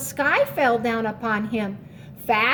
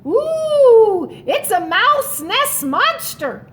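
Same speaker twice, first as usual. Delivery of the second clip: high, loud frightened squeak; squeaked fright; 7 semitones higher, 6 dB louder, slower than usual. Acted. Little Mouse squeaking at his own reflection. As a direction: squeal at the monster in fear. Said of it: "Squeaked little Mouse".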